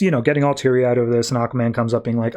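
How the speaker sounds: ordinary talk, nothing marked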